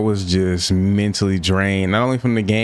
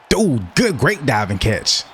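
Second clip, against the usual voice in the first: excited praise; 4 semitones higher than usual. Real, not acted. Praising a teammate's catch.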